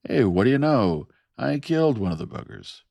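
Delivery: high, loud excited call; shouting. A narrator's reading. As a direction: call it out like news across a shop floor. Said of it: "Jerry Rivas was shouting".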